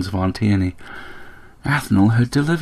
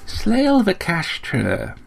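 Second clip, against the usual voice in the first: slow, high, restrained reproach; restrained regret; 10 semitones higher, much slower than usual. A narrator's reading. Aunt summons her errant nephew, immense restraint and regret. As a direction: draw the name out with terrible restraint. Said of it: "a tone that implied immense restraint and regret".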